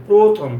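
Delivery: প্রথম as "proo-thom", loud rounded o; teacher repeats প্রথম firmly with o; correct o pronunciation. Shown as a demonstration. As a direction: punch the o in প্রথম. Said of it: "প্রথম বলি".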